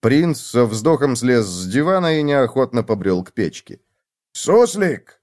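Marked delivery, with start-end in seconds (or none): 4.3-5.2 high, falling call. A narrator's reading. Hamster summoning the gopher, calling out. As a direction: call for someone in the next room. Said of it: "позвал хомяк".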